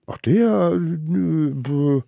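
high, loud, drawn-out stalling; caught-out stammer. Acted. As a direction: stammer guiltily for an excuse. Little ones caught, stalling guiltily. (hoch und ertappt herumdrucksend)

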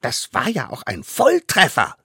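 put-on high, cocky child voice; triumphant boast. A narrator's reading. Smallest savage boasts to the court, proudly. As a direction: brag with a hunter's pride. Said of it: "trumpfte der Allerkleinste auf, nicht ohne Jägerstolz".

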